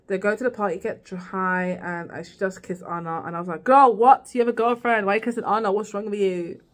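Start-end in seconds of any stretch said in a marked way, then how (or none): 3.6-6.6 outraged exclaim. Retold or imitated. Viewer scolding Jules for kissing Anna, loud and outraged. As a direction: call her out in shock. Outraged reaction to cheating kiss.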